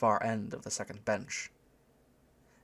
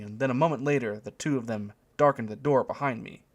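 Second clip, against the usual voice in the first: usual speaking voice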